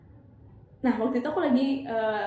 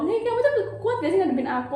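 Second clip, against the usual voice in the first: higher, louder demanding questions; angry challenge; 8 semitones higher, 3 dB louder than usual. Retold or imitated. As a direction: challenge him with rising heat. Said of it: "Aku bilang kayak gitu".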